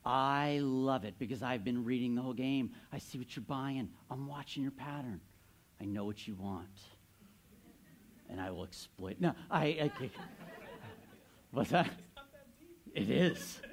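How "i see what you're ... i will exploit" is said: softer and softer, a sly predator's murmur; menacing hush. Acted. Pastor confessing his trading strategy to the congregation, in a mock-sinister hush. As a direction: drop it low like a villain revealing his plan.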